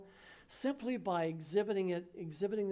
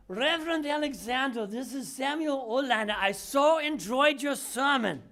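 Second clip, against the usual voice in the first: higher imitation of an old man's Polish accent; higher, louder Polish-accented old man; 5 semitones higher, 6 dB louder than usual. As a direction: put on Samuel's heavy Polish accent. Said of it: "which I won't try to imitate again".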